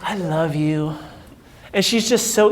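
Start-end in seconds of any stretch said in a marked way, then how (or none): 0.0-1.0 lightly voiced carefree Dory; imitating Dory. Dory voiced greeting coach.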